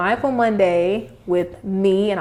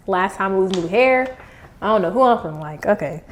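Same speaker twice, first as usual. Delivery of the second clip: usual speaking voice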